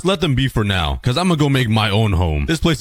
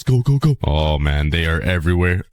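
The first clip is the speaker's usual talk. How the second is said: ordinary talk, nothing marked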